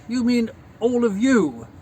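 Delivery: loud, deliberate mock correction; correcting father. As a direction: imitate a dad spelling out proper grammar. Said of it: "he'd be correcting me on grammar".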